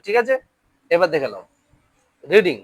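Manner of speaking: ordinary talk, nothing marked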